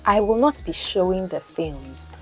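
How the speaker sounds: ordinary delivery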